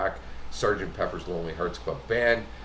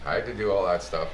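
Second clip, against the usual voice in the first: usual speaking voice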